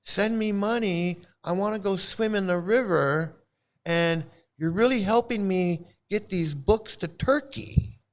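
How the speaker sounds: high, put-on pleading pitch for donations; sarcastic imitation of a YouTube monk; mocking monk begging. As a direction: sneer it like a fake holy man asking for cash. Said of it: "he can go right on YouTube and go".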